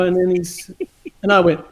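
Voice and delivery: ordinary talk, nothing marked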